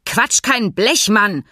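laut und höher herausplatzen, langsam betont; wütend dazwischenfahren; explodierend. Acted. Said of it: "explodierte Fink in seine Erklärung". (Fink fährt dem Tierarzt wütend ins Wort)

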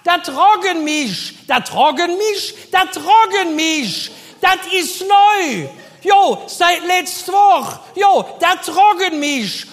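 high, loud, slow calling from afar; Helga from the back. Retold or imitated. Helga shouting from the back, slow and loud. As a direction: drag it out, shouting from the back room. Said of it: "Helga von hinten".

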